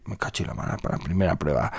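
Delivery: a hint of a gruff grumbling king; lightly voiced grumpy king; low king voice. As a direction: mutter it low like a sulky king. King voiced low in folk tale.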